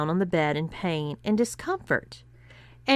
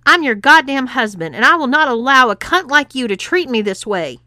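high, loud furious outburst; a husband raging at his wife; exploding in rage; 6 semitones higher, 9 dB louder than usual. A narrator's reading. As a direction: blow up at her. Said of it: "exploded Adam".

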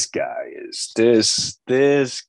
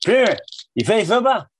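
higher, quick taunting voice; higher mock Norm challenge; 10 semitones higher, 3 dB louder, faster than usual. Imitating Norm in the hallway, higher and quick. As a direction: fire the question off high. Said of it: "I don't know".

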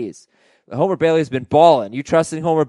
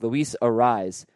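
ordinary talk, nothing marked

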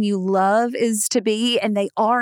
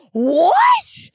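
high, loud disbelieving yelp; retold shriek of disbelief; shocked outburst; 9 semitones higher, 5 dB louder than usual. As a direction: react as if appalled by the news. Mother's retold shocked reaction.

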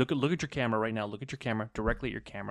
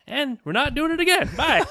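high, loud mock sign-off; mock curt sign-off; 7 dB louder than usual. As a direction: snap out a hammy goodbye. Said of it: "I was gonna be like".

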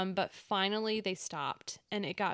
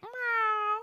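high bratty meow; cat meow; 14 semitones higher, 4 dB louder than usual. Shown as a demonstration. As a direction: meow like a person mimicking a cat. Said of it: "we do that".

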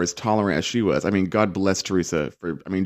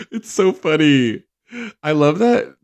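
high-pitched, tickled delivery; amused, voice lifted by laughter; laughing; 7 semitones higher, slower than usual. Real, not acted. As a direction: talk through real giggles. Laughing at the puppy moment.

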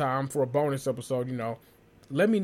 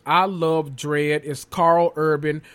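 ordinary delivery

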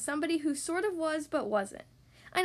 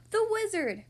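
high, sudden exclamation; excited cry; 8 semitones higher, 4 dB louder than usual. Acted. Mister Happy struck by the answer, crying out. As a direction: cry out with a bright idea. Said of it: "cried Mister Happy".

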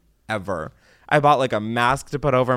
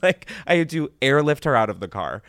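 ordinary talk

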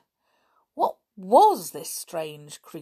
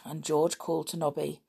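ordinary delivery

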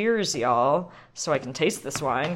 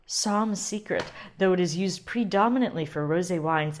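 ordinary talk

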